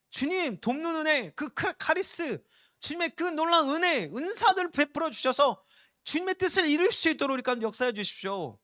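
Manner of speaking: loud, high preaching prayer; fervent prayer. Acted. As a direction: cry out to the Lord for grace. 설교 중 기도를 외침. (회중 앞에서 주님께 은혜를 구하며 뜨겁게 외치는 기도)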